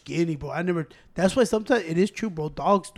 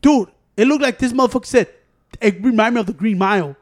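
ordinary talk

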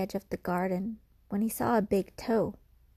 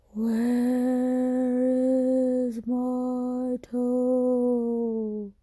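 slow, drawn-out ghostly moan, higher and louder; ghostly groan; 4 semitones higher, 5 dB louder, much slower than usual. Acted. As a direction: stretch every word like a ghost calling from the dark. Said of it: "it groaned".

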